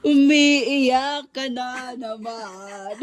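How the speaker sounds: loud, very slow laughing taunt; drawn-out tease. Real, not acted. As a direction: stretch the words out loudly to tease. Teasing friend who cries again. (mabagal, malakas at natatawang pang-aasar)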